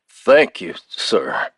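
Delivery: shaky thanks stifling a laugh; wobbling voice, holding back laughter; shakily. Acted. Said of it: "Erlan replied shakily".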